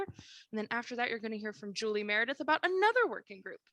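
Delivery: usual speaking voice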